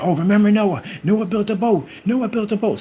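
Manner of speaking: high, urgent mock outcry; crying out in panic. Flood victims remembering Noah's boat, panicked high cries. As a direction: act out a panicked crowd calling for help. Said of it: "these people are screaming and crying".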